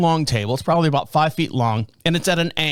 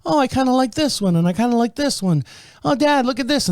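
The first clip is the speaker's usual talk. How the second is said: daughter; 7 semitones higher than usual. Retold or imitated. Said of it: "the daughter's walking around and she's like".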